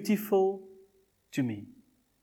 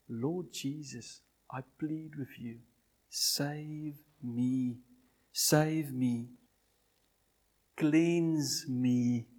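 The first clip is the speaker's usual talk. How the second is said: much softer, slow pleading; soft prayer; 4 semitones lower, 6 dB quieter, much slower than usual. Acted. Preacher voicing the sinner's prayer to Jesus, soft. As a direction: pray it quietly and earnestly.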